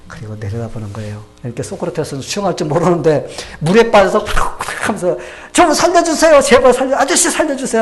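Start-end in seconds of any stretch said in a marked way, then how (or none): 5.5-7.8 high, loud cry for help from the water; drowning man screaming to be saved; desperate pleading. Retold or imitated. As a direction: beg for your life, much higher and louder. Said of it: "사정을 하는 겁니다".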